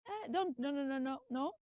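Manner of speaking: ordinary talk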